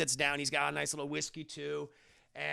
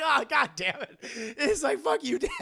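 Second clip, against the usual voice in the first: laughing; 7 semitones higher, 4 dB louder than usual. Real, not acted. Laughing while voicing.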